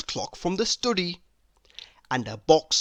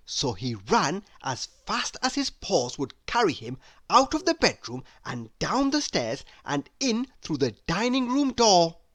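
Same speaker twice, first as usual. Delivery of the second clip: usual speaking voice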